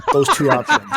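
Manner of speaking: laughing. Real, not acted. Laughing over explanation.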